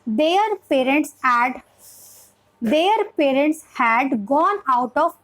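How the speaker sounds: very slow, word by word with gaps; beginner-paced reading. Shown as a demonstration. Teacher reading for a young beginner, slow and separated. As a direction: spell out the reading one word at a time. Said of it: "syllable वे में हमें पढ़ाना चाहिए".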